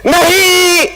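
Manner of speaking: नहीं very high and drawn out; stress on नहीं. Shown as a demonstration. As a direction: stretch नहीं up high to hammer the rule. Emphatic no in rule. (नहीं पर ज़ोर, ऊँचा खींचा हुआ)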